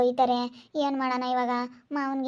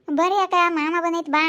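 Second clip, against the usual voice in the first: high, loud summons; girl calling her sister to come to uncle, loud and high; 4 semitones higher, 5 dB louder than usual. Acted. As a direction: call her out from the other room.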